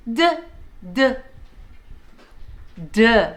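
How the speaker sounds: short, isolated "D" as "duh"; letter D sound. Shown as a demonstration. Teacher shows children the sound, short "duh" for "D". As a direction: say "D" as its sound, not its name. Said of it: "what the letter D says".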